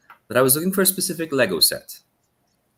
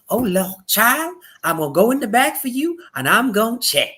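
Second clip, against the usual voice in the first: put-on Southern lady accent; 10 semitones higher, 4 dB louder than usual. Recalling the Walmart lady, he imitates her high Southern accent. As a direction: lightly put on a kindly Southern twang. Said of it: "I don't wanna do her accent".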